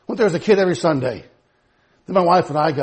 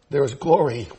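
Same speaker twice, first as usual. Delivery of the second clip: ordinary talk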